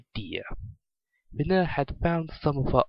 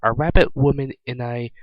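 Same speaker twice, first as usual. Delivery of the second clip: ordinary delivery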